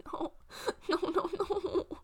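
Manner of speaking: high, slow, broken sobbing no. Acted. Green collapsed in the dust, sobbing no. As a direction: sob each no out. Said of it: "he sobs".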